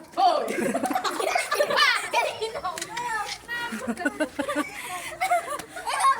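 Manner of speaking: long soft laugh. Real, not acted. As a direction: laugh quietly to yourself. Laughing at playground. (mahinang tawa)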